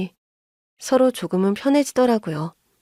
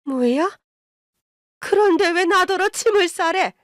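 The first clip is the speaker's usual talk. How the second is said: furious mother-in-law; 8 semitones higher than usual. A narrator's reading. Mother-in-law ordered to pack, high indignant fury. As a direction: jump into high outrage. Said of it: "노발대발 화를 내더라고요".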